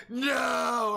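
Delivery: high, loud anguished no; retold shout. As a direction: yell it like your team just lost. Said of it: "he was like".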